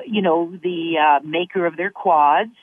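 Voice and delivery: ordinary talk, nothing marked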